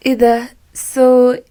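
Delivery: high, posh Arabic-English mix; Egyptian girl mixing English. Retold or imitated. Mocking Cairo girls who mix English into Arabic, high posh voice. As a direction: mimic a trendy Cairo girl showing off London. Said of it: "they speak like this now".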